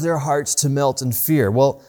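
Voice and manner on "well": ordinary talk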